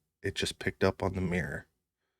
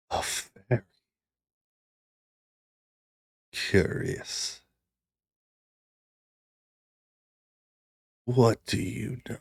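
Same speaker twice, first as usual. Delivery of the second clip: deep rumbling voice; 3 semitones higher than usual. Acted. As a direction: breathe it out like a curious dark god. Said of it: "you hear a deep, rumbling voice".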